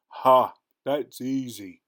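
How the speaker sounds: slow, loud scornful ogre; sneer. Acted. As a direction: sneer at the easy challenge. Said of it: "sneered the ogre".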